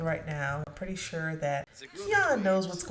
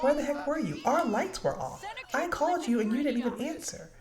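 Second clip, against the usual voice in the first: imitating a furious wife, higher pitched; mock scolding, pitched up; angry wife; 7 semitones higher than usual. Wife scolding husband for ignoring calls, angry. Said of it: "Toya is pissed".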